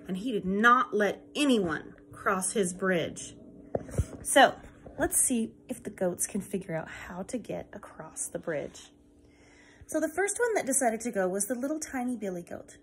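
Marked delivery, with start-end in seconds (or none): 5.0-8.9 hushed storyteller whisper; conspiratorial whisper. Acted. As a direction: whisper it like a secret to the children. Teacher whispers aside to kids.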